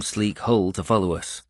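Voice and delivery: usual speaking voice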